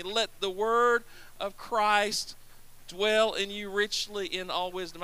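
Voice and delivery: slow, measured phrasing; slow recitation. Shown as a demonstration. Preacher leading the congregation in reciting the verse slowly. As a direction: lead them through the verse word by word. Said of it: "Say that with me".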